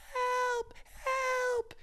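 very high, thin, far-off cry for help. Acted. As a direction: call out high like someone drifting away. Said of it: "And Nora said".

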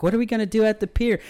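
high, loud, fast chirpy cover-up voice; a grandma loudly changing the subject; grandma talking over. Retold or imitated. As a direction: talk over them brightly and fast like a flustered grandma. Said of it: "She's like".

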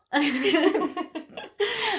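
soft amused laugh; light laugh. Real, not acted. Host laughing at her friend's try. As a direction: laugh gently at the attempt.

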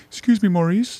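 high, prim and polite voice; overly polite. Pretend. Timid guest asking the dealer Maurice politely. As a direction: ask ever so politely. Polite request out window, joke.

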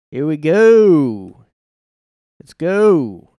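loud, high, drawn-out hype call; rousing game-on holler; hyped-up cheer. Acted. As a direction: pump up the crowd, stretching each "go". Mock hyping himself over the list.

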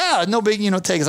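high, mock-casual bravado; putting on a show. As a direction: play the big man shrugging off an offer. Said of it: "I'm put on a show for these guys".

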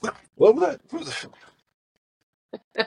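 high startled squeak. Retold or imitated. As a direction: jump at a sudden sound. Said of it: "I'm like".

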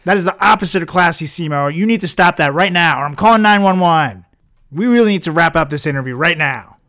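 loud, forceful reprimand; fed-up host raising the voice; angry scolding. Acted. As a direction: lay down the law at full volume. Host orders guest to stop.

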